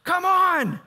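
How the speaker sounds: loud, high call to come drink; rowdy coworker shouting come on; shouted invite. Retold or imitated. Coworkers calling him to drink.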